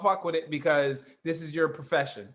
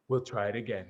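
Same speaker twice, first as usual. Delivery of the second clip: low, much softer murmur; low whisper; 11 semitones lower, 7 dB quieter, faster than usual. Retold or imitated. Lifeguard telling the sinking kid to retry, low murmur. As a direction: hush it low and flat, barely there. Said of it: "All of this in a low to, a whisper".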